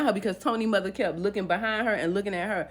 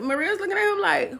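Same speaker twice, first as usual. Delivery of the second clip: plain reading voice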